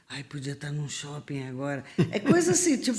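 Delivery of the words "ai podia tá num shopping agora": soft unvoiced whisper; dreaming of a mall amid combat, whispered; whispered daydream. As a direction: sigh the wish quietly. Daydream during war.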